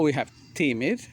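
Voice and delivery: normal talking voice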